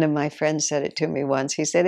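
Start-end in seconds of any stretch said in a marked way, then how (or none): none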